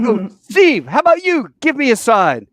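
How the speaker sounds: loud, high-pitched call to the sky; excited calling out. Retold or imitated. Calling to Steve for a sign, excited shouting. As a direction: shout it up, giddy and eager.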